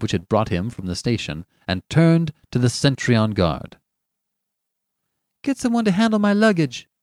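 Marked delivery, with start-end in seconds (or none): 5.4-6.9 high-pitched, brisk command; brisk order. A narrator's reading. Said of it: "he said briskly".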